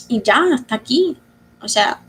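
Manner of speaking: switching into Spanish mid-thought; spanglish. Real, not acted. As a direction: slip into Spanish with "hasta aquí". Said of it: "sorry for the Spanglish".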